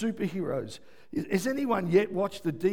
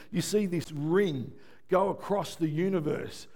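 ordinary talk

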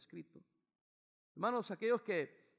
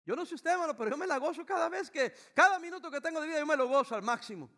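loud, high joyful preaching; shouting his joy from the pulpit; fervent preaching; 9 semitones higher, 11 dB louder than usual. Real, not acted. Preaching at full force.

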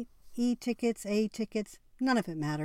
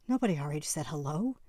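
ordinary delivery, nothing marked

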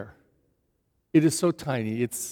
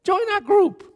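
eager invitation. Retold or imitated. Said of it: "And he says".

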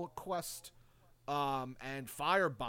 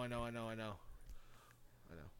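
quiet; 4 semitones lower, 13 dB quieter, much faster than usual. Real, not acted. After shout, repeats softly.

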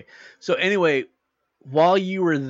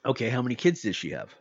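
ordinary talk, nothing marked

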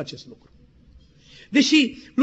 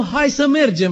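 urgent call; 4 semitones higher, 7 dB louder than usual. Retold or imitated. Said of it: "Vreau să-i zic prietenului meu".

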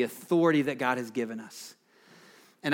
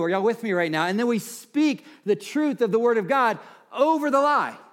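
raised, ringing pulpit voice, pitched higher; fervent preaching; 6 semitones higher, 3 dB louder than usual. Acted. Pastor urging his church to speak the word, fervent preaching. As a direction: preach it out to the whole room.

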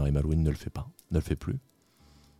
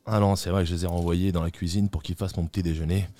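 ordinary talk, nothing marked

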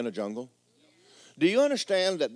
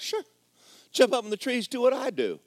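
monkey's reply; 10 semitones higher than usual. Acted. Monkey answers the creature.

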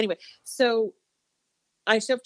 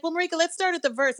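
engineer voice; 9 semitones higher, faster than usual. Retold or imitated. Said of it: "they were like".

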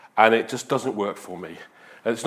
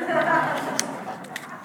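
laugh; 6 dB quieter than usual. Real, not acted. Laugh after punchline.

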